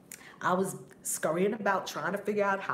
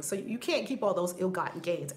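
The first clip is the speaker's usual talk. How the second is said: normal talking voice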